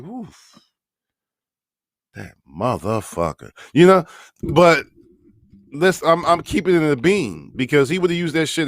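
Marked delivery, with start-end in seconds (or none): 0.0-3.5 grudging oof. Retold or imitated. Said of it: "you'd be like".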